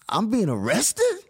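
high, rising, stunned question; shocked disbelief. Retold or imitated. Told he's going to the station, stunned high question. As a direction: gasp it out in disbelief.

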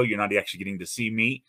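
usual speaking voice

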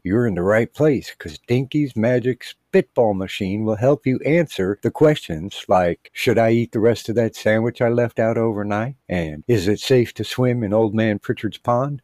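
loud, hammed-up ad pitch; podcast host read. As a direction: sell it like a cheesy radio host.

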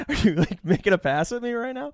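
high-pitched incredulous laughing question; incredulous high squeak. Retold or imitated. Asking the flirty nurse, in a high laughing squeal. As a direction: squeal it in disbelief, half laughing. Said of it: "I'm like".

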